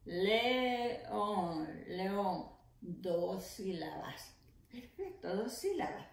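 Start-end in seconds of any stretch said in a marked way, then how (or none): 0.1-1.7 le-ón, stretched slowly syllable by syllable; split into syllables. Shown as a demonstration. Teacher to pupils, counting syllables, slow split. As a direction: tap out each syllable of "León" slowly. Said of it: "¿Cuántas sílabas tiene la palabra león?".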